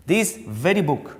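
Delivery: emphatic weight on "very"; "very" stressed deliberately as a teaching example. Shown as a demonstration. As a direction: make "very" stand out, then fall on the noun. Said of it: "I am giving more stress on this word very".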